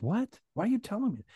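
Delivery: high, soft incredulous question; baffled. Retold or imitated. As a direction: act out hushed bewilderment. Puzzled reply to a whispered confession.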